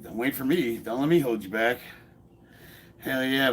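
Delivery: ordinary delivery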